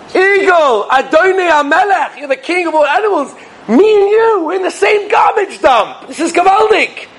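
very high-pitched, thrilled little character; excited chicken. Retold or imitated. Chicken greets the eagle in the garbage dump, thrilled high voice. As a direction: play a chicken starstruck by a king.

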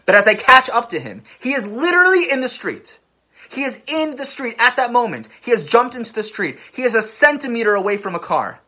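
high, loud, breathless-paced storytelling peak; urgent climax. Retold or imitated. Storyteller reliving the child's near miss with a car, urgent. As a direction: build to a near shout and hold it there.

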